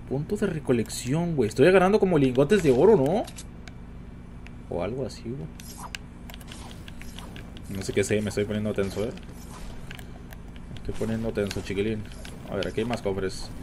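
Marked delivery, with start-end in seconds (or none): none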